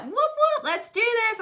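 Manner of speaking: mock whoop of a thrilled friend; excited cheer. Friend cheering the new consultant.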